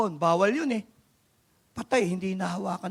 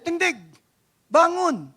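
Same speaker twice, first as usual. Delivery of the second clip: commanding call; 9 semitones higher, 5 dB louder than usual. Acted. Jesus commands the paralytic.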